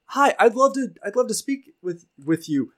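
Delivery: put-on a green young adventurer addressing a stranger; young knight's voice. Young adventurer greets the party, high and eager. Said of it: "He goes".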